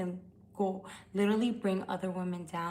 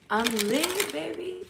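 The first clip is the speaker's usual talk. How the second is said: playful tipsy boast; 8 semitones higher, 3 dB louder, slower than usual. Pretend. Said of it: "But I am lit".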